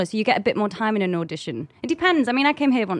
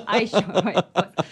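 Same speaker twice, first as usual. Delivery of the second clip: put-on LA accent. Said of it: "L A accent".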